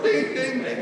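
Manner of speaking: mock kitchen timer ding, rising high on the last two; oven timer ding. Teacher joking to the class, imitating a roaster timer ding. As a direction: chime ding ding ding like a timer going off. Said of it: "when the meat's done and it goes off".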